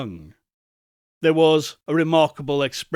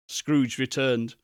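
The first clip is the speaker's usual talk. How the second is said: plain reading voice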